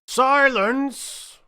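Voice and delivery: very high, loud call for silence; king calling the court to silence. Acted. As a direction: shout the court to order. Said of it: "called out".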